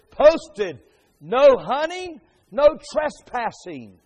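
loud, high, slow warning; posted warning sign. Acted. Preacher reads a no trespassing sign to the congregation, loud warning. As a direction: proclaim each phrase slowly and forcefully. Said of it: "is a sign that says".

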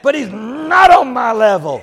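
high, loud pulpit declaration; forceful preaching. Real, not acted. Preacher setting God above himself, forceful preaching.

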